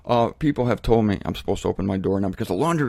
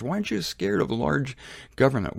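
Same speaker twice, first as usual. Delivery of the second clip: ordinary talk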